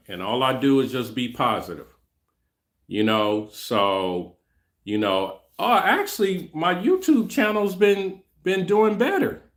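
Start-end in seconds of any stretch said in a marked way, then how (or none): 5.6-9.4 mocking. Mocks a boastful hater.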